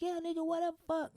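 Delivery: high, mocking girl's voice; mocking the passenger. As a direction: imitate her rude taunt way up high. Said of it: "she's like".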